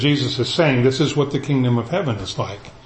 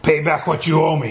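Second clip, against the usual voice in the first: demanded; 2 semitones higher than usual. A narrator's reading. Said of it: "he demanded".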